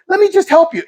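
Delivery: high, eager-to-help voice; nice drug dealer. Retold or imitated. Drug dealer helping neighbor with his cans, high and helpful. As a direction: offer help in a high, friendly voice. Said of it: "actually a nice drug dealer".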